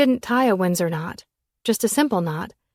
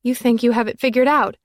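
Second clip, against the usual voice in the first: higher, louder retort; indignant raised question; sharp challenge; 4 semitones higher, 6 dB louder than usual. A narrator's reading. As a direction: challenge him hard.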